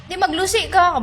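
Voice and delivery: telling off. Real, not acted. Telling off a rude commenter.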